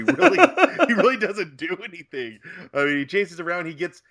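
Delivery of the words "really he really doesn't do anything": laughing. Real, not acted. Laughing at a lazy performance.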